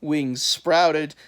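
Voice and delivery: ordinary delivery, nothing marked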